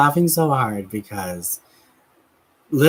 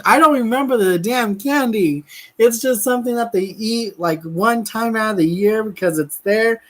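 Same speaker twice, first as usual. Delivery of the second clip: high, exasperated rant about the candy; pitched-up frustrated babble; 9 semitones higher than usual. Real, not acted. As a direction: throw up your hands over the forgotten name.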